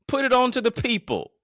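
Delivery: high, loud proclaiming call; big loud preacher delivery; loud preaching shout. Acted. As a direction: belt it to the crowd. Repeats line loud, then calm.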